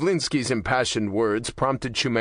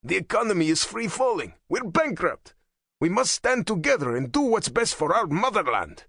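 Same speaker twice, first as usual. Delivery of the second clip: raised, urgent plea; exclaiming; 9 semitones higher than usual. Acted. A hardliner urging Shumenko, exclaiming high. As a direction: press it hard, pitched up high. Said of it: "he exclaimed".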